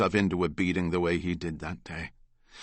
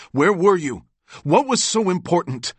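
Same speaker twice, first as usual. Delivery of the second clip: loud and high, grabbing his brother's shoulder; urgent loud demand; 10 semitones higher, 7 dB louder than usual. Acted. Albert demands where his brother went, loud and urgent. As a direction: demand it loud, almost shouting.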